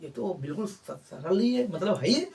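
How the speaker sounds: imitating students. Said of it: "अब बच्चे कह रहे होंगे".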